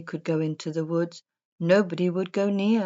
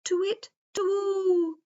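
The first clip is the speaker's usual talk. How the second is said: owl hoot; 13 semitones higher than usual. A narrator's reading. Old wise owl calls over the woods, hooting. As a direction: hoot it like an owl, long and high. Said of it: "he hooted".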